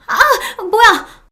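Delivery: high-pitched shriek of shock; startled scream. A narrator's reading. Person caught in the bedroom as the door flies open, startled shriek. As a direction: cry out as if caught off guard. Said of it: "경악해서 소리를 질렀습니다".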